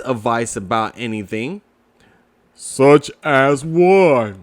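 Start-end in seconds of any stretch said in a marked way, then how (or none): 2.6-4.4 gloomy Eeyore. Acted. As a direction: drag it out like sad Eeyore. Eeyore voiced in story.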